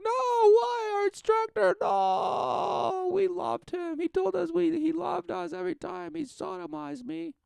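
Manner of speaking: very high falsetto lament; mock wailing pupils. Pupils lament their instructor to the boss, mock wailing. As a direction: wail in hammed-up falsetto protest.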